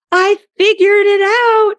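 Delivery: high, loud shout of discovery; excited yell. Retold or imitated. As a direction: yell it out from another room, thrilled. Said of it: "he yells from the bathroom".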